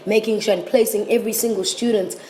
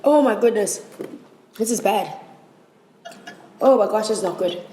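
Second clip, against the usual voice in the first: mouth burning from spicy food. Real, not acted.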